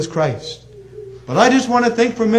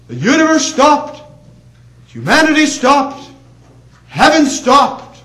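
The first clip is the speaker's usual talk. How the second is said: loud, slow, rising sermon build; full-force revival preaching; fiery preaching; 6 semitones higher, 5 dB louder, slower than usual. Acted. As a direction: preach it with mounting force to the congregation. Preaching climax.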